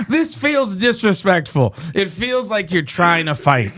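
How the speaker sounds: high, slow mock indignation; mock offended. Wronged customer confronts the burrito thief, hammed-up offence.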